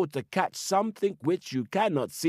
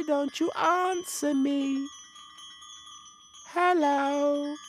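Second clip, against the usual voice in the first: high, slow, gentle forest spirit; sweet gentle spirit voice; 12 semitones higher, slower than usual. Acted. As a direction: coax from the dark woods, high and sweet. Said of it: "sweet, gentle voices saying".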